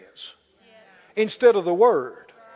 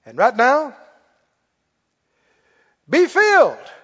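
ringing command; 3 semitones higher, 8 dB louder, slower than usual. Retold or imitated. Commanding the crowd to be filled.

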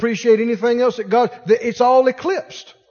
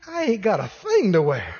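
mock disgust; 2 semitones lower than usual. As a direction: sneer at the closet with a laugh. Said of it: "Open the closet".

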